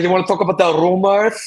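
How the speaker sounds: mock Spanish accent. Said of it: "It was very Spanish".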